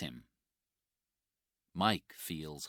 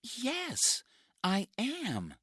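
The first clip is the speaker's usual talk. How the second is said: put-on young woman answering; 5 dB louder, slower than usual. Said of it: "she says".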